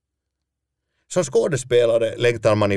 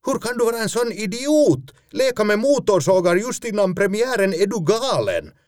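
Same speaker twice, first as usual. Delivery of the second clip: much higher, scolding outburst; outraged producer; 13 semitones higher than usual. Retold or imitated. Producer berates actor for playing with chainsaws, high furious pitch. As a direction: tear into him for his stupidity.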